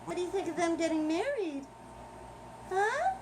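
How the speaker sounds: high coaxing questions to the pet; pet talk. Real, not acted. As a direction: tease a reply out of her.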